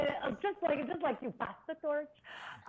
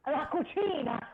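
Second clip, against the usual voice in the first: put-on Italian accent; 5 semitones higher, 5 dB louder than usual. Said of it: "Go to an Italian woman".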